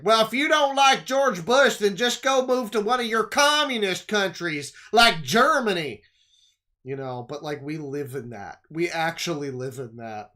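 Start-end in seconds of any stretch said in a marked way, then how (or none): 0.0-6.0 much higher, louder caricature of a Bush supporter; mocking jingoist rant; mock flag-waving patriot. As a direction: ham up a smug loud patriot. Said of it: "and it would be like".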